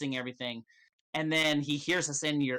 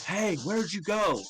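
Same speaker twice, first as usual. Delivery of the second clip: slow, higher calling out; shouting; 5 semitones higher, slower than usual. Acted. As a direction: call down the hall like a guard. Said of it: "you hear them begin to shout".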